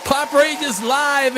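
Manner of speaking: big high hype call to open the show; hyped livestream host yelling the opener; shouted show opener. Real, not acted. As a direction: announce Saturday night like a host. Opening his live show.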